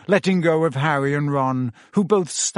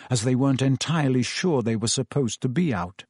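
plain reading voice

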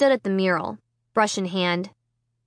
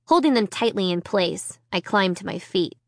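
usual speaking voice

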